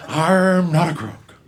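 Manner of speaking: slow, loud Nixon growl; Nixon impression. Comic mocking the drunk comedian as Nixon, slow and loud. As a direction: deliver it like Nixon denying everything. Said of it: "his voice is like an old man".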